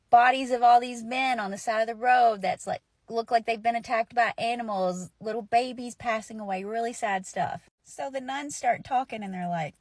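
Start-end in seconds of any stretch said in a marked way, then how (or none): none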